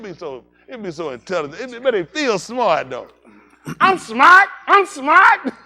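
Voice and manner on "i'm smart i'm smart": mock proud adult. Mocking adults who feel smart.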